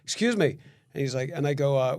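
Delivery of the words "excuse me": higher, polite opener to the stranger; polite approach. Retold or imitated. Neighbor approaching a sedated stranger, higher polite call. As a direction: get his attention gently but up high. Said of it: "I'm, I'm like".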